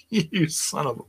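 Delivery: half-swallowed insult through real laughter; cracking up too hard to finish the insult; laughing mid-insult. Real, not acted. Laughing at Mark's crude joke, insult breaks off in real laughter.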